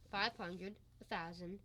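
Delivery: low, soft tallying aloud; counting to himself. Acted. Man counting found money to himself, quiet tally. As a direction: count under your breath, absorbed.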